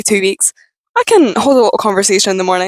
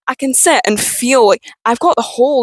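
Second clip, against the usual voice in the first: usual speaking voice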